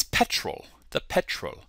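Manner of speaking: hushed put-on British accent; quiet British imitation. Shown as a demonstration. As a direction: say it softly as a Brit would. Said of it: "give you my British accent".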